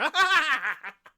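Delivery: high, loud burst of helpless laughter; hysterical gnome laugh. Acted. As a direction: laugh like you can't stop. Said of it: "his yelling turns to laughter".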